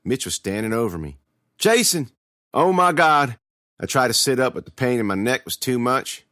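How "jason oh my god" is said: raised, high-pitched alarm; alarmed cry. A narrator's reading. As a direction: cry out over your hurt brother. Brother finds narrator wounded after attack.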